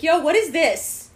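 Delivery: high, loud, drawn-out outraged demand; retold teenage outburst; losing it. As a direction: demand answers at full volume. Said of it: "lost my shit".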